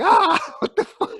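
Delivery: hammed-up startled cry, laughing; shocked yelp. Mocking the woman under the broken table, laughing shriek. Imagined woman hit by glass.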